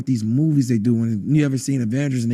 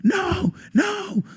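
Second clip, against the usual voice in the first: re-enacted freak-out; much slower than usual. Man fearing he'll be pulled down, acted panic. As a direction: act out refusing to calm down. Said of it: "I'm freaking out".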